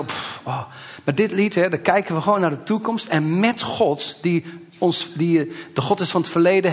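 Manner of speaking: ordinary talk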